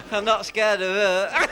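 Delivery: slow words squeezed out through giggles; trying to talk through his own laughter; laughing. Real, not acted. Comic quoting a woman in the crowd, laughing.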